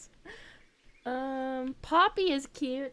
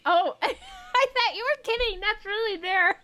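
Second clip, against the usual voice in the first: high, louder, laughing in real surprise; amused disbelief, pitched up; 10 semitones higher, 3 dB louder than usual. Real, not acted. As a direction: laugh it out, caught off guard.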